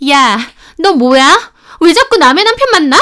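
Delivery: loud yell, rising high and fast at the end; furious shout. Acted. Mistress grabbing the wife's hair, screaming accusations. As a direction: scream it while yanking her hair. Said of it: "하면서 소리를 지르더라고요".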